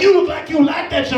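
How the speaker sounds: high, indignant imitation; mock outraged rant. Same man accusing him.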